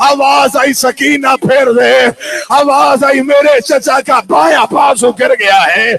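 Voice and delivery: forceful, anguished narration; impassioned majlis preaching. Acted. Preacher relays Sakina's report of the battle, anguished declamation. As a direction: drive the lament toward its peak.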